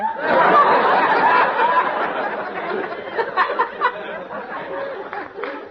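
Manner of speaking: long high-pitched country whoop of a laugh; high hillbilly cackle. Acted. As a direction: let loose a big yodelling laugh. Judy's trademark laugh.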